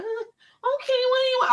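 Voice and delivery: mocking the teachers who cower before the principal, high and timid; meek teacher. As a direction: play the pushover teacher, small and high. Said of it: "teachers that was gonna be like".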